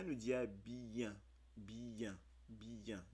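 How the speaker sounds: bien said as bi-en, split into two syllables; teacher spells out "bien" in two beats. Shown as a demonstration. Teacher to learners: "bien" slowly split into syllables. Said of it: "quand on prononce bien".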